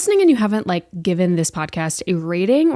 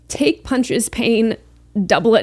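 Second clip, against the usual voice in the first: ordinary delivery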